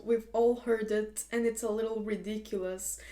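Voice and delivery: ordinary delivery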